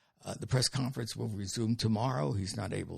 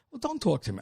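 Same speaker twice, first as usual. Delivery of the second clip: higher, louder offended snap; play-acted outrage; mock indignant retort; 10 semitones higher, 4 dB louder, faster than usual. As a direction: fire back at the insult. Yelling back at the dead phone.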